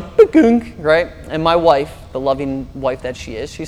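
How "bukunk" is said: imitated high electronic bukunk; mock GPS beep; GPS chime noise. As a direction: make the phone's alert sound with your mouth. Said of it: "the cell phone's going".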